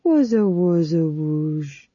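silly cooing pet noises; baby talk to a cat. Acted. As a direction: coo nonsense at the cat like a pet owner. Fool baby-talking to a cat.

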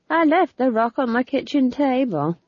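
Nanny's voice. A narrator's reading. Old witch telling friends about her ghosts, chatty.

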